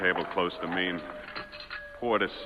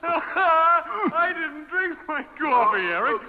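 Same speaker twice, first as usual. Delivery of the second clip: high, slow, loud gloating; gloating triumph; 4 dB louder, slower than usual. Acted. Victim gloating at the man who poisoned him, high slow triumph. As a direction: relish every word of the reveal.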